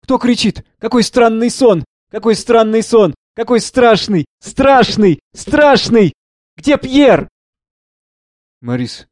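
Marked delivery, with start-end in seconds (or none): 0.0-7.3 high, loud, fearful repetitions; frightened. A narrator's reading. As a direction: cry out as if trapped in a bad dream. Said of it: "Испуганно". (испуганно)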